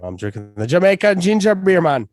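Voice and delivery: hammed-up Jamaican accent, pitched high. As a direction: play at a loud, lilting Jamaican accent. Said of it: "That's how Jamaican people speak".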